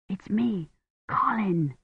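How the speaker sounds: hushed, reassuring whisper. A narrator's reading. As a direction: whisper softly so nobody hears. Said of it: "he whispered".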